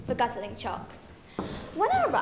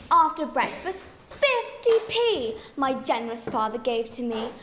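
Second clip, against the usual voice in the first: usual speaking voice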